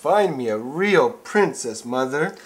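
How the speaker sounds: loud, slow plea; pleading prince. Acted. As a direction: beg your mother dramatically.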